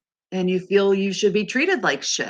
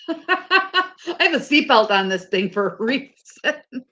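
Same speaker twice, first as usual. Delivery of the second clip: laughing while talking; 7 semitones higher, 3 dB louder than usual. Real, not acted. Laughing about Tom running.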